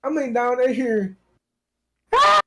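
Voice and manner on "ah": sudden imitated cat yowl, like a woman yelling. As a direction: imitate a cat's human-sounding scream. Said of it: "it sounds like a woman yelling".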